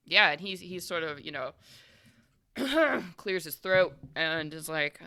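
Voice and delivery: ordinary talk, nothing marked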